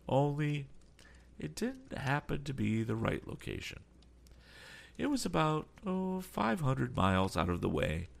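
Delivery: a lightly voiced, polite old sea captain; courteous old captain. A narrator's reading. Sea captain answers Tom's questions, lightly voiced and polite. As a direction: play the captain, measured and courteous.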